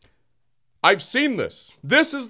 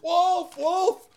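crying wolf; 10 semitones higher than usual. Pretend.

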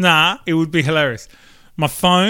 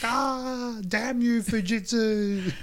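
mock cursing; 8 semitones higher, 5 dB quieter, much slower than usual. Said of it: "And you're like".

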